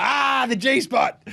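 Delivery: high, slow, drawn-out cry of recognition; mock excited exclamation. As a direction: draw out the Ah high and gleeful. Acts out spotting the car.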